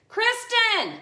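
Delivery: loud, high holler for someone downstairs; full-voiced summoning shout; real yell. Real, not acted. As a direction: call out sharply across the house. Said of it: "Sorry for the yell".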